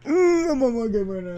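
high, whiny mocking repeat; whiny mock gamer. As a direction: whine it like a sulky gamer.

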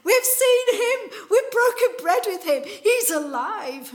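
high, loud tumbling rush of news with a laugh; giddy shout of news; excited disciples. Retold or imitated. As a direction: blurt out the joy, laughing.

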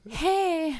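high, loud, bright service voice; fake customer service cheer. Mocking her customer service self, high fake cheer. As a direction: put on the cheerful shop greeting. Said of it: "unless I'm doing customer service".

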